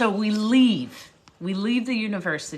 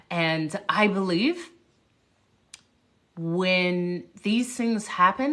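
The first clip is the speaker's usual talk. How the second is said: ordinary delivery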